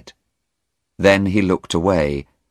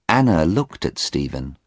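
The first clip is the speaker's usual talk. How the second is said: usual speaking voice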